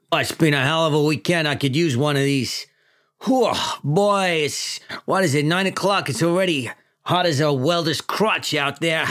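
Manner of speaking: New York chief griping to his men; hammed-up old-school cop boss; weary police chief. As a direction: complain like a worn-out precinct boss.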